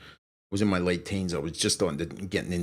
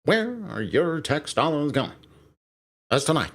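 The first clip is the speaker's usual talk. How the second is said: raised, projected TV anchor delivery; Kent Brockman; 7 semitones higher than usual. Acted. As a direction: announce it like a pompous cartoon newsreader. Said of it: "anchor man".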